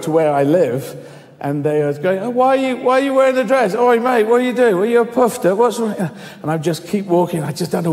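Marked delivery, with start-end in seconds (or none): 1.9-5.9 high, needling street heckle; mock bad-girl voice, pitched up; taunting teenage girls. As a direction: pester a stranger with jeering questions.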